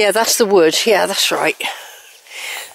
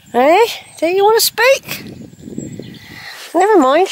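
higher coaxing voice; pet talk; 9 semitones higher than usual. Real, not acted. Horse won't come, higher coaxing voice. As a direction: gently coax the shy horse.

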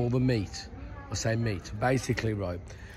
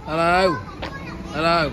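called out high and loud; loud, high hello to a dog; calling out; 6 semitones higher, 4 dB louder than usual. Real, not acted. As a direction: call to the dog across the street.

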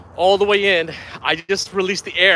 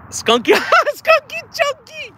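very high, laughing squeal; giddy, squeaky laughing voice; cracking up; slower than usual. Real, not acted. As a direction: stretch it out slowly through laughter. Cracks up at the boat name.

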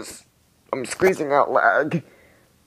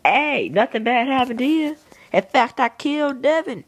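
mocking mob voice; 11 semitones higher than usual. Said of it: "So they're just like".

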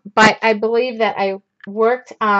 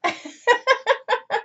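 a high, trailing laugh; 13 semitones higher, 3 dB quieter than usual. Real, not acted. Painter laughing at herself on stream, high laugh. Laughing after complaining about heat.

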